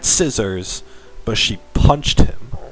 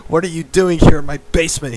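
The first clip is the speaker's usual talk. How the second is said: higher, fast angry demand; shout; 7 semitones higher, 3 dB louder, faster than usual. A narrator's reading. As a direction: bark the question at the intruder. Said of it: "he shouted at me".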